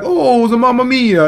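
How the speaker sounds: mock the Italian players with a singsong Italian accent. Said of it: "the Italians".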